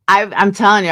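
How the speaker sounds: normal talking voice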